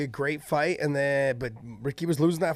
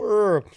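dazed groan; 5 semitones higher, 6 dB louder than usual. Retold or imitated. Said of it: "I was just all".

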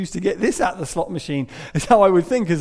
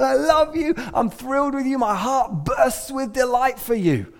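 higher, laughing delight; joyful dancing God; 7 semitones higher than usual. Retold or imitated. Proud dad God dancing over his kids, laughing with joy.

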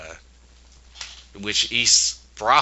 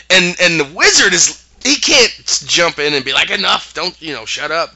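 excited retelling; 8 semitones higher, 7 dB louder than usual. Retold or imitated. Retelling wizard wanting to intervene.

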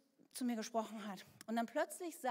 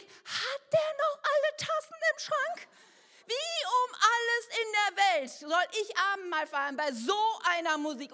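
loud, high, slow indignant rant; angry outburst; 7 semitones higher, 8 dB louder than usual. Retold or imitated. Churchgoer fuming at loud communion music, angry. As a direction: fume at the blaring music. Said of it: "ich war so, so zornig".

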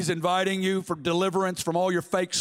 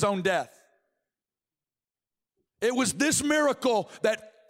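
high, ringing sermon delivery; impassioned preaching; 6 semitones higher than usual. Real, not acted. Preacher telling the congregation of God's vulnerability, impassioned. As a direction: preach it with mounting fervour.

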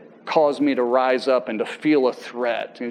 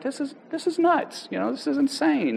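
much higher, exasperated outcry; 12 semitones higher, 3 dB quieter than usual. Retold or imitated. Son stuck on hold with the courts, exasperated outcry. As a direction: throw up your hands at the absurdity. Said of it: "I'm like".

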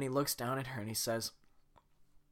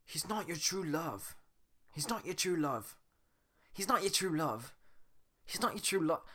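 attempted Scottish, slipping into British; British-sounding accent; 5 semitones higher than usual. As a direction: Shrek line that drifts British. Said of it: "it keeps sounding so British and not Scottish like Shrek is".

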